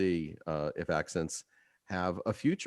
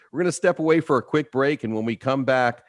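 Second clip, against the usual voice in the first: bright upbeat throw to the break; announcer energy going to commercial; host teasing what's next, upbeat; radio host break tease; 6 semitones higher, 6 dB louder than usual. Acted.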